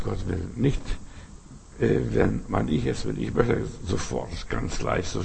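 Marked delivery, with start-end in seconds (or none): none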